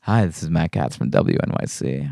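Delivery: low smooth radio announcer; sexy radio voice. Retold or imitated. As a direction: purr it like a late-night DJ. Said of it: "sexy, uh, radio voice".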